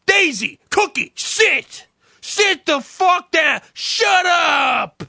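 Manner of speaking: high slow mock shout at the dogs; loud, high, drawn-out mock yelling; imitated yell. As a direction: yell at two dogs like a mob guy. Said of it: "He had to yell at Daisy".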